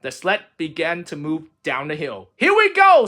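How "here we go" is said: high, loud here we go; excited start. A narrator's reading. Frog pushing off down the hill, high and excited. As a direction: launch it with glee.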